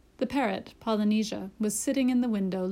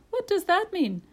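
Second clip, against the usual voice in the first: high-pitched, astonished question; amazed question; 10 semitones higher, 3 dB louder than usual. A narrator's reading. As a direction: ask it in wonder. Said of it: "cried the doctor".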